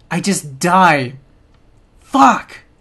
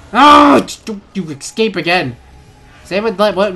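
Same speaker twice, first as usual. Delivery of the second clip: usual speaking voice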